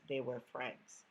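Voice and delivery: ordinary delivery, nothing marked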